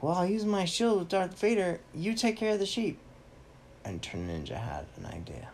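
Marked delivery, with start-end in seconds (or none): 0.0-3.0 higher-pitched acted hero voice; Captain America. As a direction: hero giving orders, pitched up. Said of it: "Captain America's like".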